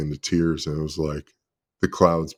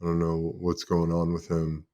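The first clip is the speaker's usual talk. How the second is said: mild tearful imitation; 3 semitones lower, 7 dB quieter than usual. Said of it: "was just in tears and said".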